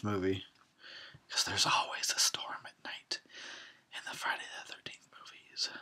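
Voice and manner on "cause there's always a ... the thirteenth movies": soft secretive aside; hushed conspiratorial aside; whispered aside. Real, not acted. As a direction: murmur it like a secret. Aside to audience.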